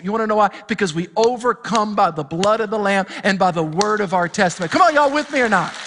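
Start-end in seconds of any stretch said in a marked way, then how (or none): 4.7-5.7 high, loud, stretched-out sermon delivery; fired-up preaching. Acted. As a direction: preach it like the Spirit is moving. Pastor rallies congregation.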